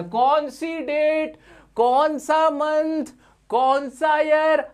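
high, very slow, stretched questions; drawn-out questioning. Acted. Teacher quizzes class on which date, drawn-out questioning.

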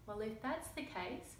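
lively picture-book read-aloud with character voices; reading to children. A narrator's reading. Reading a picture book to young children, lively storytelling. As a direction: tell the story to little listeners.

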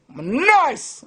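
"I'm nice" lifted high, in a show voice; high put-on boast. Shown as a demonstration.

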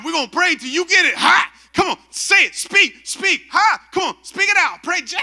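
high, shouted revival-preacher commands; hammed-up pastor barking short orders at full force; pushy pastor. Pastor pressuring a man to speak in tongues, frenzied shouting. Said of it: "and the pastor is just".

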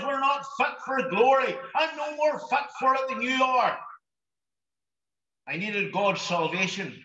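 normal talking voice

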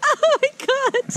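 high, giggly panicked cry; laughing shriek. Real, not acted. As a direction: cry out through nervous laughter. Laughing and scared on steep slope.

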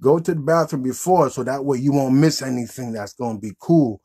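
ordinary talk

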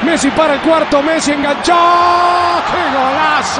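rising excited goal call; breakneck goal commentary. Real, not acted. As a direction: shout the goal with joy.

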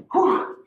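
long hard exhale; blowing out hard. Real, not acted. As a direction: puff the air out hard. End of a hard exercise round.

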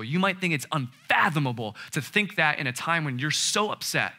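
normal talking voice

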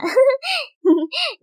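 high, airy impish snicker; mischievous giggle. Acted. Girl gloating over hiding mom's Coke, mischievous giggle. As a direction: giggle like a prankster pleased with herself. Gleeful laugh after prank.

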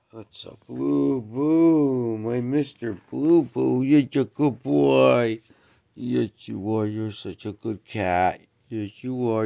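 slow, loud, high cooing to the cat; drawn-out pet talk. Real, not acted. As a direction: stretch the words out lovingly to the cat.

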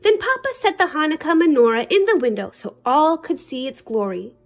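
plain reading voice

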